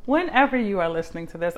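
normal talking voice

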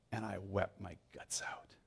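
whispered, hushed admission; whispered confession. Retold or imitated. Peter ending his story to Mark, whispered. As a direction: breathe it out as a secret.

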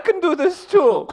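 high put-on voice; high-voiced visitor. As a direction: chime in as a squeaky visitor.

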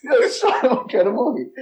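laughing repeat. Retold or imitated.

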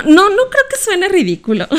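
real laughter through the words; laughing. Real, not acted. Laughing as she reassures the co-host it isn't ridiculous. Laughing reply to "ridículo" remark.

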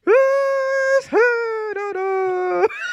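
high wordless announcer wail; mock ring announcer howl, stretched out; exaggerated sing-out noise far above normal pitch; Dave Penzer. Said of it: "He's just like".

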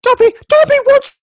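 very high, loud house-elf squeal; hammed-up, high-pitched elf voice; Dobby. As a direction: squeak it out like an excitable little elf. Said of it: "that house elf's name from".

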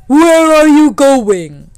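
booming, high, drawn-out demand; loud, very slow challenge, pitched high; challenging lightning. Acted. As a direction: call it out slowly as the mighty lightning.

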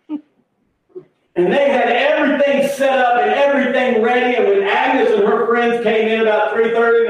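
high, building narration; excited preacher's storytelling. Real, not acted. Preacher builds up to the surprise party, high excited telling.

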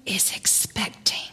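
hushed Southern whisper of expecting; whispered in a Southern accent. Retold or imitated. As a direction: breathe it out like a secret. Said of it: "she would whisper in her very Southern accent".

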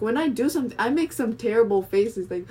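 usual speaking voice

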